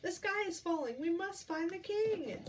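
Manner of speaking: hen and chick crying the alarm; squawking and clucking. Acted. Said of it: "squawking and clucking".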